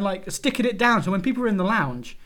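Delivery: ordinary delivery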